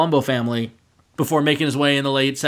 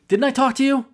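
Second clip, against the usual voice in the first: higher, pointed scolding question; 9 semitones higher than usual. Retold or imitated. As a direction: press him like a scolding boss. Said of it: "very pointedly asking Johnny".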